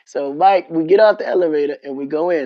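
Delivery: ordinary talk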